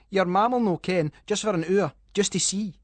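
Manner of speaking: cheeky Scottish lad egging on; coaxing boy. Acted. Liam coaxing Callum into dare.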